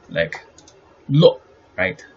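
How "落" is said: 落 cut short and punched loud; entering tone. Shown as a demonstration. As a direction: say 落 as one abrupt, stressed syllable. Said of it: "The entry sound".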